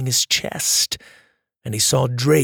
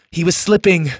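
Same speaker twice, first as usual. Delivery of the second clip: usual speaking voice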